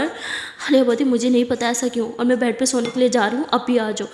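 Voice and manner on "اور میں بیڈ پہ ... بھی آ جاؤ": plain reading voice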